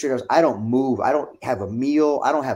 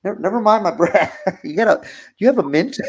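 embarrassed laugh; 7 semitones higher, 4 dB louder than usual. Retold or imitated. Embarrassed about breath, laughing.

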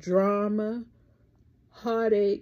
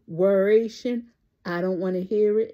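ordinary talk, nothing marked

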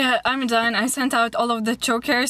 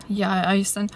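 ordinary talk, nothing marked

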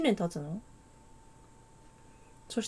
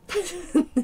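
surprised laugh; 9 semitones higher, 4 dB louder than usual. Real, not acted. Name typo surprise.

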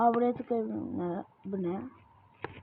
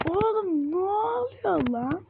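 high, slow, crying wail; half-sobbing in fright; freaked-out gamer whimpering at the screen; whiny panic; 9 semitones higher, 4 dB louder, slower than usual. Real, not acted. Scared while playing the game.

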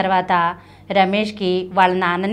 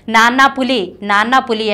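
ordinary delivery, nothing marked

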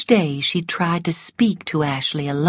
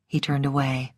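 ordinary delivery, nothing marked

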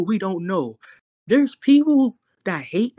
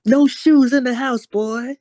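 high mom order; hammed-up mother's scolding; mock scolding mom; 5 semitones higher, 3 dB louder than usual. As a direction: lay down a house rule in a pitched-up mom voice. Acting out his yelling mother.

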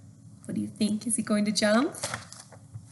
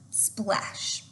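soft unvoiced splash; whispered splash; 6 dB quieter than usual. A narrator's reading. Reading to kids, whispering the splash. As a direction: breathe the splash out.

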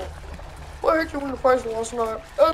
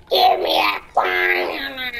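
mock baby cry. Silly baby voice bit.

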